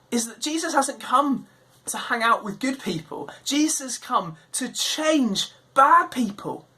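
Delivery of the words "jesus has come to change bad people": ordinary delivery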